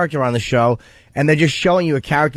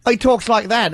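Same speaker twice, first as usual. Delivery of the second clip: higher-pitched imitation British accent; 8 semitones higher, slower than usual. Host showing how the actor sounds in interviews, mock British accent. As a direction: mimic a British speaker, pitched up. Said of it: "British accent when he's doing interviews".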